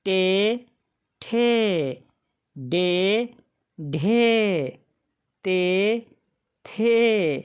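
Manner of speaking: each syllable with the e vowel, paused and spelled out one by one; teacher reciting के, खे, गे in a steady drill. Shown as a demonstration. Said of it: "यहाँ पर देखें आप".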